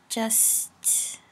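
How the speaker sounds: mumbled under the breath; muttering. Acted. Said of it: "she mutters".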